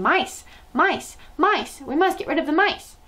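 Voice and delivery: high frantic yell. A narrator's reading. Hotel crowd yelling at the swarming mice, frantic. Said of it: "everyone was yelling".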